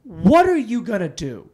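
high, loud taunting questions; acted indignant comeback; retold challenge. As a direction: throw the challenge back loudly. Answers the bystander's challenge.

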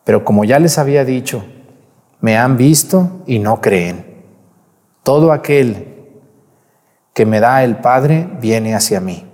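loud, solemn church reading; Gospel proclamation. Acted. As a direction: proclaim the scripture to the congregation.

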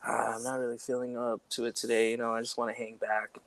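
mild, subdued letdown; acted not feeling it. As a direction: play a withdrawn roommate, quietly. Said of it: "if we're not feeling it".

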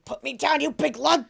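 mock scream. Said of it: "And I'm screaming".

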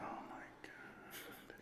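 slow breathy whisper; whispered dread. Retold or imitated. Text says it's a mess, slow whisper. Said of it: "I'm like".

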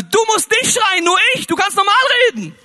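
high, loud, fast shouting wife. Retold or imitated. Wife to her deafened husband, a loud high shout. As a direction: yell it so the deaf man hears.

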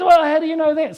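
high, loud, anxious challenge; panicky retort. Retold or imitated. Scared passenger doubting the narrator, high anxious retort. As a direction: push back in fear.

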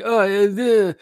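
half-hearted mock whimper about the cold; big baby whine. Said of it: "I'm a big baby about it".

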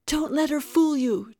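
loud, high-pitched frantic yell; screamed. A narrator's reading. Tara fighting off those who grab her, screamed. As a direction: scream it as a desperate accusation. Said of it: "Tara kicked and screamed".

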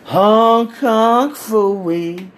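put-on slow, drawn-out drunken slur of Hong Kong Phooey. Drunk friend slurring the dildo's name, very slow. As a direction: stretch each word like a drunk man. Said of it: "drunk as fuck".

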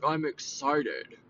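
low, deadpan excitement; sarcastic-sounding. Shown as a demonstration. Host showing husband how her excitement comes across, deadpan. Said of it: "it sounds like".

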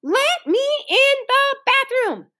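high, slow, drawn-out wail; screamed demand. A narrator's reading. Ramona demands the bathroom from the hall, screaming. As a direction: shriek each word to be let in. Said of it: "screamed Ramona from the hall".

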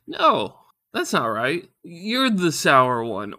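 loud high "No", then slow insistence; flustered comeback. Retold or imitated. Husband's lame comeback to wife, loud and slow. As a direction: hit back with drawn-out "You're the sour one". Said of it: "I kinda stumble with my words".

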